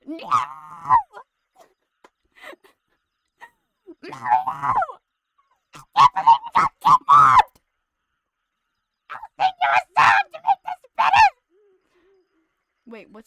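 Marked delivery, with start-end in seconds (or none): 0.1-7.6 shrill, much higher and very loud Toad squeal. Acted. Streamer voices Toad wailing that he got kidnapped, loud shrill squeal. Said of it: "What do you think about this, Toad?". 9.1-11.3 fast, shrill and much louder Toad squeal. Acted. Toad offers a song to cheer everyone up, frantic squeal. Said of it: "What do you think about this, Toad?".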